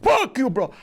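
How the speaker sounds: put-on high-pitched indignant heckler in a botched Jamaican accent; weird Jamaican accent. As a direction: imitate an angry Jamaican, accent off. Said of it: "he was Jamaican".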